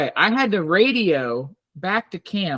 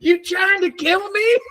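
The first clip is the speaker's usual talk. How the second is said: mock outrage; 11 semitones higher than usual. Mom voiced in cabin story.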